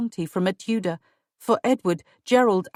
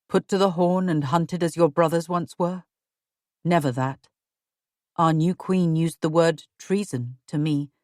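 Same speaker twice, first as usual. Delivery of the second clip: lower, grave husband voice; husband's voice; 3 semitones lower than usual. Acted. Husband warning his wife of treason, lower and grave. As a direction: voice a worried husband, lower and steady. Husband Edward speaks in dungeon.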